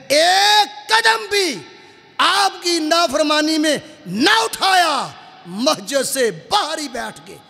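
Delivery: high, loud, drawn-out exclamations; impassioned preaching. Real, not acted. Preacher extolling a companion's obedience to the congregation, fervent and high.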